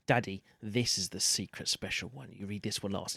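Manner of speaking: put-on soft, secretive little boy; hushed child voice. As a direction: whisper it like a secret. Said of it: "He'll be like".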